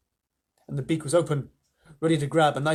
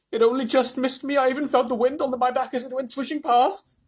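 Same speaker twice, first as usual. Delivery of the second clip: high, loud anxious complaint; wailing; 10 semitones higher, 4 dB louder than usual. A narrator's reading. Earthworm just missed by the beak, high wailing. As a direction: wail about a narrow escape in a raised pitch. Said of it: "wailed the earthworm".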